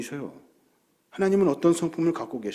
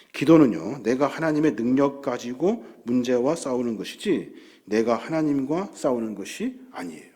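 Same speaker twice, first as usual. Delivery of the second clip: normal talking voice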